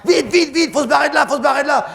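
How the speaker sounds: urgent shouting. Retold or imitated. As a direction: rush everyone off the rocks. Sauveteurs pressés de fuir. (les sauveteurs crient de filer, voix aiguë et forte)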